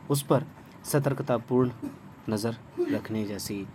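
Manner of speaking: usual speaking voice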